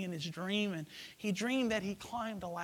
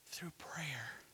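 whispering; 4 semitones lower, 14 dB quieter than usual. Acted. Echoed softer.

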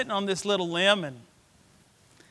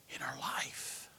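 much softer whispered close; 8 semitones lower, 19 dB quieter, slower than usual. Real, not acted. Preacher dropping to a whisper on the life they forsake. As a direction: let it fall to a whisper.

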